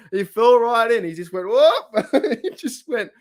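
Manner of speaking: laughing through the story; cracking up as he tells it. Real, not acted. Laughing to viewers about the pool fall.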